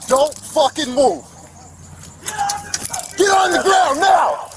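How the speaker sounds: high, loud barked police order; shouted command. Real, not acted. Officer to suspect during arrest, shouting orders. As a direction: shout him down to the ground.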